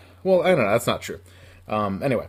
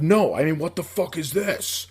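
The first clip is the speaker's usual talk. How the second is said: sudden hushed threat; menacing serious; 3 semitones higher, 3 dB quieter than usual. Retold or imitated. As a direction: turn deadly serious like Joe Pesci. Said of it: "my Joe Pesci ... moment".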